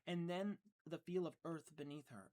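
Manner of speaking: usual speaking voice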